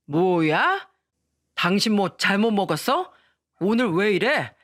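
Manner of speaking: taken-aback husband's challenge; acted blustering dad caught off guard; flustered father. A narrator's reading. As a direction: question your wife in startled indignation. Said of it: "당황한 얼굴을 한 채".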